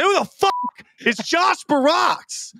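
high, slow, incredulous mock question; baffled mom. Mom confused at the son's stream name, high and incredulous. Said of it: "I said".

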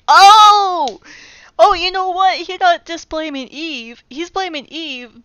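loud high burst of discovery; sudden realization. Real, not acted. Reader catching Adam blaming God, loud and high. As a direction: shout out the discovery.

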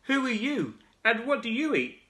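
high calling shout; frog shouting. Acted. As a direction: call out to the emu. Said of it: "shouted the big wide-mouthed frog".

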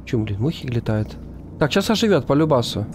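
normal talking voice

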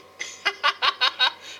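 rapid run of chuckles; amused staccato giggle; laugh. Real, not acted. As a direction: laugh lightly at your own remark. Laughs at own declaration.

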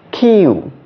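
Q high and falling, leaning on its "you" sound; emphasized U sound. Shown as a demonstration. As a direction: stretch the vowel in Q with emphasis. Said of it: "A little more emphasis".

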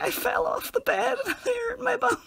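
very high, squeaky giggling whine; tearful laughing. Retold or imitated. Explaining the injury to a school friend, tearful laughing. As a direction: barely get it out through laughter.

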